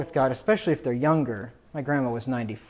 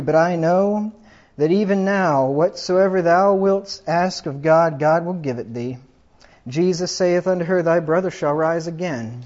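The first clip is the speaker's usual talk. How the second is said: raised, louder preacher's reading of the gospel; scripture reading; 4 semitones higher, 6 dB louder than usual. Acted. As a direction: proclaim the verses to the congregation. Reads scripture aloud.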